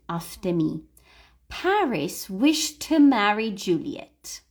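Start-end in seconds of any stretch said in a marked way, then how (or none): 1.5-4.4 slow, careful reading for learners to repeat; slow model sentence. Shown as a demonstration. Teacher reading the model sentence slowly for repetition. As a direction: spell it out slowly so they can copy. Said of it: "listen to the whole sentence".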